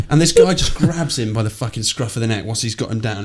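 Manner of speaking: usual speaking voice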